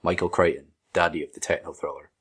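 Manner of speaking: normal talking voice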